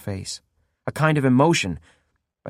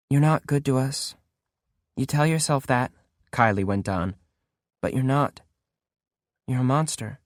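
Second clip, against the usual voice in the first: emotionless; 4 dB quieter than usual. Acted. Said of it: "for all the emotion in her voice".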